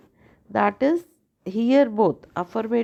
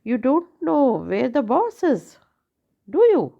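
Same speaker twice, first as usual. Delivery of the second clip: "do you?" swooping up high and louder; rising tag; 5 semitones higher, 3 dB louder than usual. Shown as a demonstration. Teacher demonstrating a real question tag with rising tone. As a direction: lift "do you?" sharply as a genuine question. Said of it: "that will be the rising intonation".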